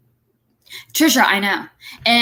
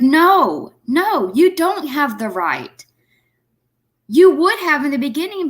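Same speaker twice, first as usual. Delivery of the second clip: raised, louder, slowed angry outburst; mad; 6 semitones higher, 3 dB louder than usual. Real, not acted. Reacting to the clip, gets mad and louder. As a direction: let the anger rise at the mother on screen. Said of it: "it makes me so mad".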